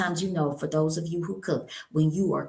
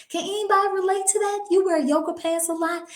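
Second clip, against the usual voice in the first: playful high question; 14 semitones higher, faster than usual. Real, not acted. Asks audience playfully.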